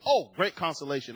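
ordinary talk, nothing marked

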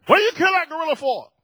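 high, loud, fast indignant question; hammed-up outraged public; mock outrage. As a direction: mimic the crowd upset about the gorilla. Mocking upset people.

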